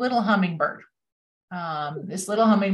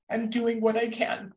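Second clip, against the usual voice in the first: choked-up, really moved quotation; emotional; 5 semitones higher than usual. Real, not acted. Quoting the hummingbird to thank teachers, genuinely emotional. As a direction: hold back tears while quoting. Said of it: "I get emotional about this".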